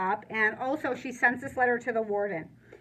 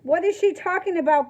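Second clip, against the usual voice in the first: louder, higher roar; 4 semitones higher, 5 dB louder, faster than usual. Acted. Warden roaring at the children, loud. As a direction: roar it at the kids. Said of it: "the warden roars".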